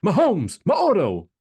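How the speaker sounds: high, loud imitated sideline call; mock coach's shout. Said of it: "you hear a coach go".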